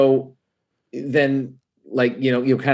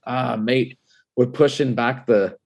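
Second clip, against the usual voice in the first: higher-pitched Scottish brogue; 5 semitones higher than usual. Retold or imitated. Distillery caller in Scotland postponing the tour, Scottish brogue. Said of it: "You actually do a good brogue".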